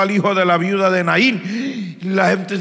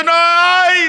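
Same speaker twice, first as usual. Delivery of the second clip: high, loud yelp of fright; startled yelp; 11 semitones higher, 6 dB louder than usual. Acted. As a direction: yelp as if a ghost appeared. Disciples see Jesus on water.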